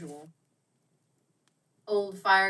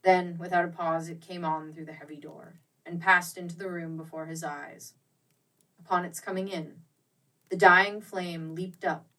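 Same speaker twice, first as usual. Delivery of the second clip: usual speaking voice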